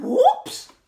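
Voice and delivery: very loud, high, rising whoops; old lady's whoops. Acted. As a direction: whoop it out in mock alarm. Little old lady's blunder cry.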